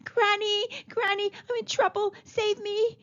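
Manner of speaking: put-on high child's plea; crying for help. A narrator's reading. Boy attacked by ants calls granny, high cry. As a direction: call for rescue, pitched well up. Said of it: "Chiku cried".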